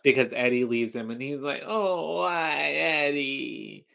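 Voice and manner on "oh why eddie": long drawn-out, imitated lament; mock wail. Strangler abandoned by his partner Eddie, mock anguished wail. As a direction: stretch each word into a hammed-up cry.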